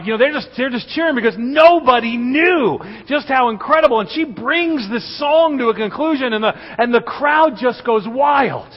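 high, loud, driving sermon climax; preaching at full force. Acted. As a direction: preach it to the rafters as the crowd rises. Builds story to a climax.

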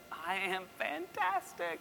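high, soft, slow squeak; squeaky reply. Retold or imitated. Acted flustered reply to the president.

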